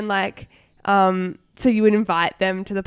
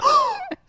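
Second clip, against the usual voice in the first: very high, sharp mock gasp; shocked gasp. Boy hearing her swear, high shocked gasp. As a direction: gasp like a scandalised kid.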